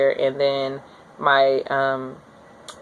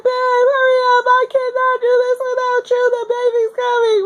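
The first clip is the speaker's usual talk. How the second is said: high, loud crying call; desperate yell; 4 dB louder than usual. Retold or imitated. Calling her husband to hurry as the baby comes. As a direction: urge him to hurry.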